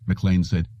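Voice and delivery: usual speaking voice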